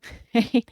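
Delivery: quick amused laugh; a brief chuckle; short laugh. Real, not acted. Laughs after the impression.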